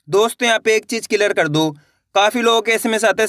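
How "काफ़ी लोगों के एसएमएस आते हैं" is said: normal talking voice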